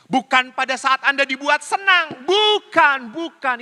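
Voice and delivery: preacher stressing not when pleased; emphatic insistence. Real, not acted. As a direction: drive the point home.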